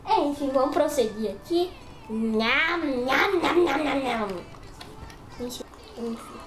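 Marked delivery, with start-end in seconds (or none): 2.1-4.3 nom nom chewing noises. Pretend. Munching noises while eating.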